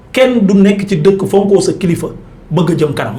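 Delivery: ordinary delivery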